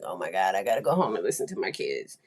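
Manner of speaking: low, rushed weary grumble; dragged-down, hurried inner complaint; tired parent. Retold or imitated. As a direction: sigh through it like a worn-out parent. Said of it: "Sometimes I'm so tired".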